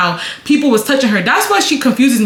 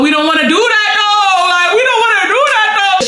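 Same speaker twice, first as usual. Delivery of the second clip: whining plea; 10 semitones higher than usual. Retold or imitated. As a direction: plead with a stubborn friend, much higher. Retold plea to drunk friend.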